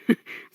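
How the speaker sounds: short, real chuckle; brief spontaneous laugh; amused laugh. Real, not acted. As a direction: chuckle at something just read. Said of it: "Sorry, I'm laughing at the comment".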